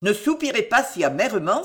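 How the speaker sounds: ordinary delivery, nothing marked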